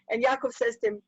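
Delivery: ordinary delivery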